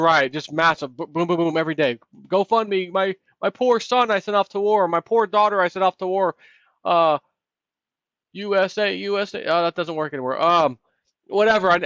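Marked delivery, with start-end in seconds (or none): 2.3-9.4 higher, hammed-up begging for a son at war; mock pleading parent. Parent begging GoFundMe donors to bring a soldier home, mock pleading. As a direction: play a desperate parent begging online.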